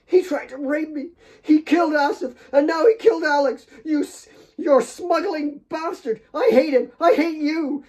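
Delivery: high, loud, tearful screaming; hysterical rage. A narrator's reading. Kate screaming at Claire after being held back. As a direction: scream every word through hysteria. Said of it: "spat the words in her ear".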